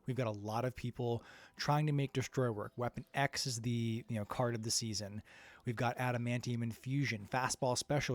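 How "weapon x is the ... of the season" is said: ordinary talk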